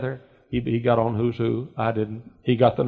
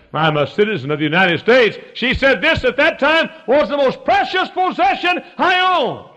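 rising from raised voice to high shout; preached testimony; 6 dB louder than usual. Retold or imitated. Immigrant testifies to her citizenship, retold in impassioned preaching.

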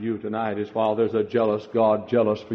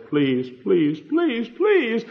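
begging please; 12 semitones higher, much slower than usual. Acted. God pleading with the sinner.

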